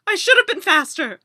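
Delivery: ordinary talk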